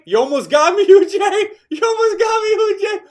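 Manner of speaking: excited laughing shout. Real, not acted. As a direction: yell it out through laughter. Reacting to chat, laughing.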